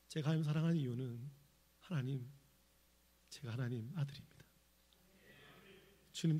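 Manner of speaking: heartfelt prayer. Retold or imitated. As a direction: pray quietly, as if close to tears. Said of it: "똑같은 고백이 되는 거예요".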